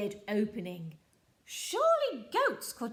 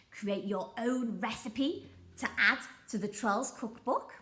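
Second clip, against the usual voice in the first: ordinary delivery, nothing marked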